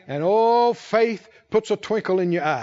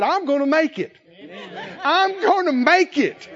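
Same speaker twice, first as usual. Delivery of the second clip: joyful laughing shout; 7 semitones higher than usual. Retold or imitated. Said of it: "And I shouted and I said".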